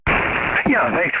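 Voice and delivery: rough, gravelly morning voice. Real, not acted. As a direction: voice still rough from sleep. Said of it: "Sorry for the gravelly voice there".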